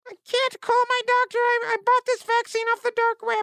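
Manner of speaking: high-pitched helium voice. Acted. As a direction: talk like you just inhaled helium. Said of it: "helium voice".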